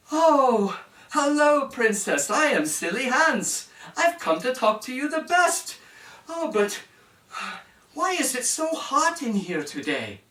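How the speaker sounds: high, loud and cheery; Silly Hands greeting the princess, high and loud. Acted. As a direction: greet her like a jolly fool.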